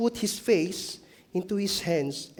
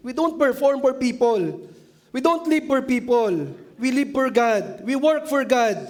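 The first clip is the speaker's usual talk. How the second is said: higher, louder preaching declaration; preaching emphasis; 7 semitones higher, 4 dB louder than usual. Real, not acted. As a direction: proclaim it to the congregation. Sermon climax declaration. (malakas at mataas na pangangaral)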